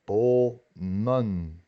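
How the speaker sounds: "bomen" slowly, with a long O; long double vowel. Shown as a demonstration. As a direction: split "bomen" in two, holding the first vowel. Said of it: "pero se pronuncia doble".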